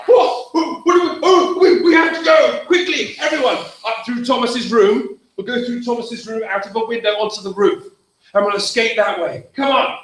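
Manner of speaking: rally the family before the smoke reaches them; urgent orders. Acted. Mister Farron urgently calls his family out through the roof.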